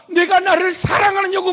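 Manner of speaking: high, loud impassioned question; shouted version of the question; crying out. Acted. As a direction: make the question ring through the room. Jesus's question cried out.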